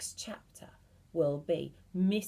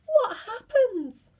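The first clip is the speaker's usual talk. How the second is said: much higher, louder and slow; frightened high squeak; 9 dB louder than usual. Acted. Mrs Twit terrified, asking her husband what happens.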